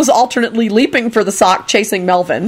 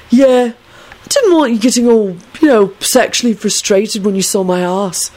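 mildly self-righteous stoned rambling; understated drugged earnestness; 3 semitones higher than usual. A narrator's reading. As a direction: explain with a touch of righteousness. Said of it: "Neville continued self-righteously".

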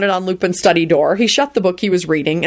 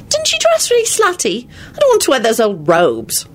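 put-on girlish voice; 7 semitones higher than usual. A narrator's reading. Girl character voiced by narrator.

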